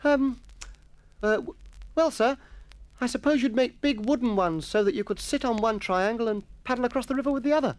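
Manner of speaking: put-on high, hesitant young boy stammering an answer; a flustered schoolboy caught out in class. A narrator's reading. Schoolboy answering his master, high and hesitant.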